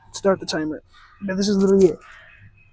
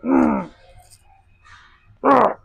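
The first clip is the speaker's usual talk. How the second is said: straining; 8 semitones higher, 5 dB louder, much slower than usual. Real, not acted. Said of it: "My body's already shaking".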